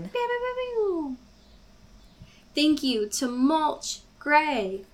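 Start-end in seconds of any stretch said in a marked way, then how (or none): 0.0-1.2 high zappy laser pews; laser pew sounds. Pretend. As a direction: fire off little laser blasts.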